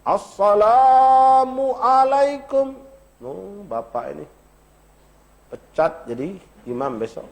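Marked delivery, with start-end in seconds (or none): 0.0-2.7 assalamualaikum warahmatullah very slow, higher and louder, every letter crisp; correct salam. Shown as a demonstration. Ustaz giving the closing salam as an imam would. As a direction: call the salam out slowly like an imam. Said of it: "Assalamualaikum warahmatullah".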